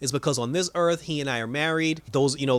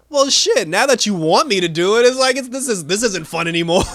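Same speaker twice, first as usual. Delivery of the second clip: exasperated; 6 semitones higher, 7 dB louder than usual. Retold or imitated. Irons loses interest in killing.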